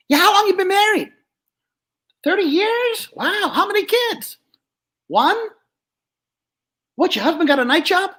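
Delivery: high, brash dummy voice; Joey the dummy. Acted. Dummy flirting with the lady down front, high and brash. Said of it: "So here's the joke".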